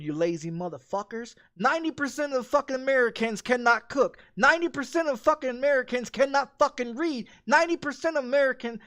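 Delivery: ordinary talk, nothing marked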